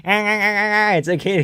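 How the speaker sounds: high, very slow squeaky reassurance; squeaky Zalal voice. Retold or imitated. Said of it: "his squeaky ass voice".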